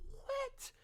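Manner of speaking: very high squeal of disbelief; astonished. Real, not acted. Host reacting to a player's stats, astonished squeal. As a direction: squeak it out in amazement.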